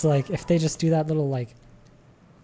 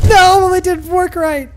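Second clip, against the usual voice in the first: much higher and louder, exasperated; frustrated yell; 10 dB louder than usual. Real, not acted. Streamer watching his trap fail, exasperated yell. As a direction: yell out the failure. Trap fails to catch raider.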